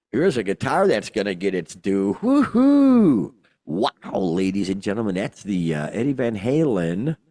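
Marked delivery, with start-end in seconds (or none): 2.2-3.3 high, loud whoop of delight; excited whoop. Real, not acted. Host cheers the auction guitar with a loud, high excited whoop. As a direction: cheer it out like a fan.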